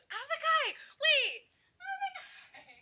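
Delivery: very high, loud squeal of delight; excited greeting shout. Real, not acted. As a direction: shriek a friend's name on spotting them. Calls out to greet arriving chatter.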